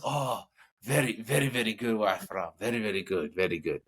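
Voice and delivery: put-on lightly accented Japanese approval, settling down in pitch; mock Japanese accent. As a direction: imitate an approving Japanese executive. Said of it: "Japanese executives".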